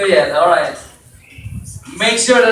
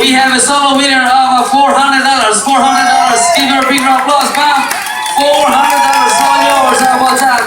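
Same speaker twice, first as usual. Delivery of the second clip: loud, higher winner announcement; bingo caller; 5 semitones higher, 5 dB louder than usual. Acted. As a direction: hype up the winner for the room.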